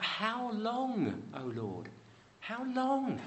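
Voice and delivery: high, pleading lament rising to a near wail; anguished cry to God. Acted. Preacher voicing the faithful crying out to God, high pleading lament. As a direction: cry it upward like someone who has waited too long. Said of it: "so often people cry out".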